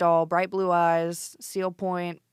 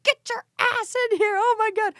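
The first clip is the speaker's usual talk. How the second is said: much higher, louder crying relief; 4 dB louder than usual. Retold or imitated. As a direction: squeal through tears at the found cat. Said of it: "I'm crying".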